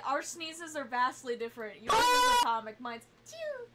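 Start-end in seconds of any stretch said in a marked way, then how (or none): 1.8-3.7 imitated sneeze. Comparing their two sneezes.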